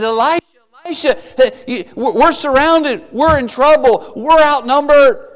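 acted fright, stammering slightly; scared servant. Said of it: "he's scared to death".

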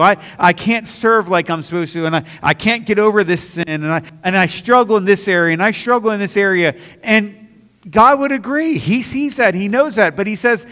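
high, loud, driving sermon voice; rising fervent preaching; preaching at full force. Acted. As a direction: preach it out over the congregation. Preaching climax, raised voice.

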